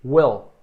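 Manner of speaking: Will said with a short clipped i like "wel". Shown as a demonstration. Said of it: "ele tem um I bem curtinho".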